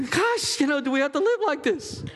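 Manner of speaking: high-pitched, hurried mock complaint; exasperated wife. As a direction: whine it in a put-on squeaky wife voice. Still acting the exasperated wife.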